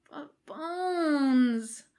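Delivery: high pleading whine; whining. A narrator's reading. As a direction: whine it like a kid. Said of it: "Jim whines".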